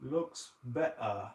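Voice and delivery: slow, tentative British accent attempt. Shown as a demonstration. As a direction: try it slowly with a British accent. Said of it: "try to say it in a British accent".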